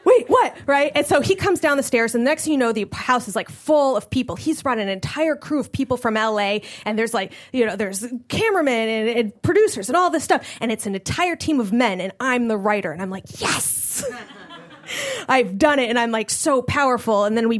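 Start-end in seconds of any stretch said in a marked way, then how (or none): 0.0-0.5 high, loud, incredulous blurt; mock-stunned yelp; startled double take. Repeats the stunned reply. 13.4-14.1 triumph. Retold or imitated. Triumphant at being the writer.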